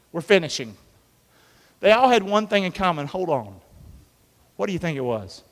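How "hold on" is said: ordinary talk, nothing marked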